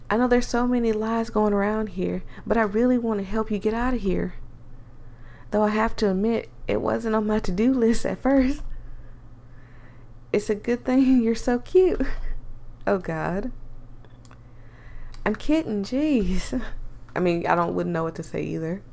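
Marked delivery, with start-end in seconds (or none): 0.1-12.0 put-on secretive country girl voice; Southern accent. Voices Allie. 15.2-18.8 put-on secretive country girl voice; Southern accent. Voices Allie.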